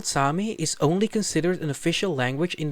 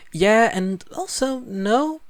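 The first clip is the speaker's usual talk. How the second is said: playful hedge; 6 semitones higher, 3 dB louder, much slower than usual. Real, not acted. Playful self-contradiction.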